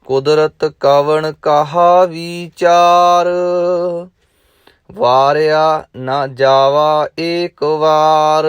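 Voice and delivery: slow, measured chanting recitation; gurbani recitation. Acted. Teacher reciting Japji Sahib to students, measured chant. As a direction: intone each line with devotion.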